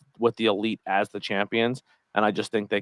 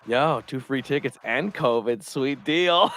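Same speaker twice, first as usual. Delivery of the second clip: sarcastic; 3 semitones higher, slower than usual. Retold or imitated. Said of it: "I was like".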